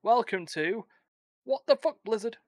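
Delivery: high, loud show intro; show announcer. Acted. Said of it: "this is where I take over".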